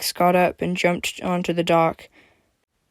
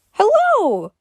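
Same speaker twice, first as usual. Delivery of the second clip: cheerful; 5 dB louder than usual. Acted. Cheerful greeting.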